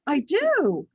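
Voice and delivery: "Yes" jumps up high, eager and bright. Retold or imitated. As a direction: accept the adventure with a joyful leap. Answering the Lord's invitation.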